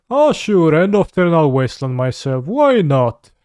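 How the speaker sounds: loud, high mocking sarcasm; sarcastic. Real, not acted. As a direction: sneer it out loud. Mocking player's self-wasteland.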